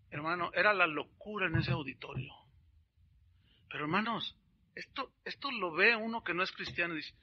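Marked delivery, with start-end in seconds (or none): none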